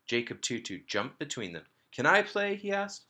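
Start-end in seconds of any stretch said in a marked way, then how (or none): 1.9-3.1 put-on little boy. A narrator's reading. Little boy begging to join.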